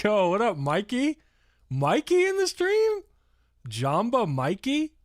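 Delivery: high, drawn-out call to a viewer; excited greeting. Real, not acted. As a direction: call out Mikey's name with delight. Greets viewer Mikey in stream.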